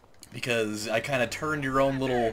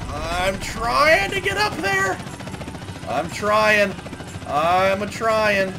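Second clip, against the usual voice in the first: high, slow strained little voice; loud, high hillbilly-ish struggle; straining cartoon voice; 8 semitones higher, 5 dB louder, much slower than usual. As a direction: squeak it out like he's struggling to climb. Character straining to climb up.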